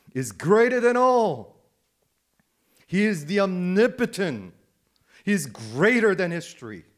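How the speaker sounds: full-voiced exalting declaration; impassioned proclamation. Real, not acted. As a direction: proclaim it big, stretching the key words. Preacher proclaims the Father's greatness.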